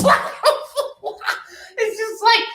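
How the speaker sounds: shrieking laugh into words; can't stop laughing; real laughter; high laughter. Real, not acted. Laughing at title card.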